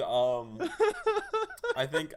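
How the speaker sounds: ordinary talk, nothing marked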